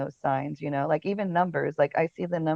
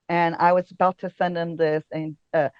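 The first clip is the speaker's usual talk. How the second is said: ordinary delivery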